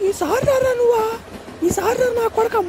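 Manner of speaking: tearful scolding through sobs; wife sobbing at the station; crying. Acted. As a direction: cry the words after him. Wife still weeping at parting.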